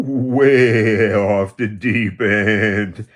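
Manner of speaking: put-on old man taunting a critic, slow and loud; slow mocking drawl. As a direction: drag it out slowly and loud. Mocking after the whoop.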